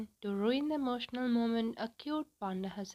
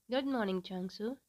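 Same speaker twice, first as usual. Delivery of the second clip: ordinary delivery, nothing marked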